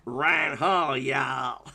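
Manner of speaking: lightly faked American Southern drawl; southern accent. Shown as a demonstration. Streamer showing what Sam could do, rough southern American accent. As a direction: attempt Sam's American Southern twang. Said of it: "I'd improve with a southern accent".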